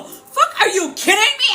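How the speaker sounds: high-pitched, laughing incredulity; appalled disbelief. Retold or imitated. Reacting to a guy expecting her energy instantly, laughing disbelief.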